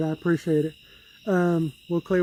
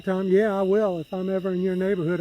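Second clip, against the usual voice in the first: ordinary talk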